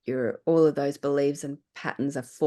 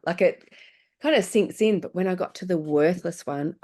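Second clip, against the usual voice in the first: ordinary talk, nothing marked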